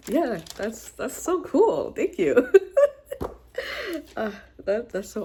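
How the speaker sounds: high, delighted thanks breaking into laughter; touched and delighted. Real, not acted. Thanking a viewer for a surprise book, touched and delighted. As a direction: squeal your thanks, laughing.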